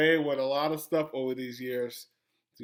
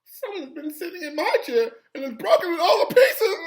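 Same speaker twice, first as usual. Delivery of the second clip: high, loud mock crying that ends in a long wail; hammed-up tiny tearful squeal; wee little Baby Bear voice; 14 semitones higher, 7 dB louder than usual. A narrator's reading. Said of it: "cried in his wee little voice".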